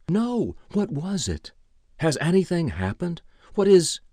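high, anxious questioning; alarmed. A narrator's reading. Ira learning he missed a message, alarmed. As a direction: fire questions in rising alarm. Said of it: "Ira Bennett's eyes widened".

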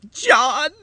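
high, drawn-out desperate call; frantic woman calling for help; distressed cry. A narrator's reading. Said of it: "cried Edith, and fled to him wildly".

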